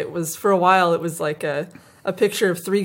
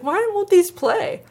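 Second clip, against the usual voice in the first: high, drawn-out plea; high, slow mock distress; distressed whine; 12 semitones higher, slower than usual. Retells own baffled complaint at broken videos.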